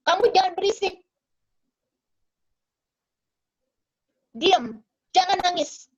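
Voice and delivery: retold motherly telling-off; mama snapping. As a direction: act out a mother shushing a crying child. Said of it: "di mana mamanya bentak".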